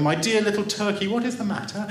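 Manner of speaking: high, concerned motherly exclamation; exclaiming. A narrator's reading. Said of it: "she exclaimed".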